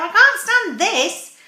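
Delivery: loud, high, slow storybook complaint; exasperated Mister Bear. Acted. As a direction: wail it slowly, utterly fed up. Fed-up bear exclaims in story.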